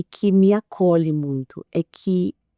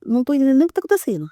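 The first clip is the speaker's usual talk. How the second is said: rushed, confused; fast flustered retort; 5 semitones higher, much faster than usual. Retold or imitated. Mother hit by a sudden outburst from her daughter, rushing. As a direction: blurt it out fast. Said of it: "recebo e falo".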